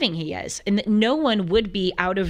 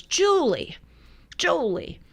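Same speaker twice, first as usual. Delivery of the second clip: higher-pitched, badly faked Bronx accent; struggling Bronx accent; 8 semitones higher, slower than usual. Host quotes her devout Bronx friend, in shaky Bronx accent. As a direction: imitate a Bronx friend, drawn out, not quite landing it. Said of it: "I'm struggling with the Bronx accent".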